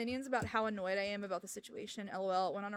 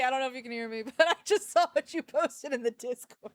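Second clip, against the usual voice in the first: ordinary talk, nothing marked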